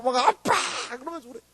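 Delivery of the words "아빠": high, drawn-out call; mock a child wailing for a parent; imitated child wail. Lost child demanding dad, high mock wail. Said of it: "빨리 보내달라고 막".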